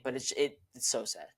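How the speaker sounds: real quiet dejection; disappointed. Real, not acted. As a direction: let the voice sink in disappointment. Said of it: "it disappoints me".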